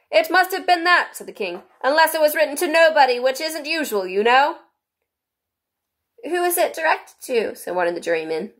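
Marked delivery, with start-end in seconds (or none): 0.1-4.6 high, loud king voice. A narrator's reading. 6.2-7.5 high, eager juryman. A narrator's reading. Juryman voiced.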